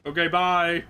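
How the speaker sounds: usual speaking voice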